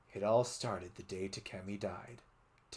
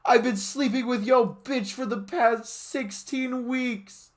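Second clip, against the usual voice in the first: taunt; 14 semitones higher, 7 dB louder than usual. Acted. Taunting confession.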